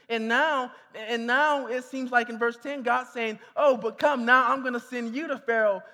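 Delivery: fervent sermon delivery; preaching. Acted. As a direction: drive it home to the congregation. Preaching the Moses passage with force.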